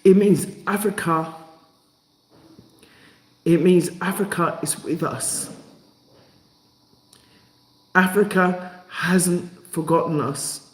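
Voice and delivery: choking up, halting between phrases; overcome, voice catching; emotional. Real, not acted. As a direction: fight back real tears, with long pauses. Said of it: "I feel emotional".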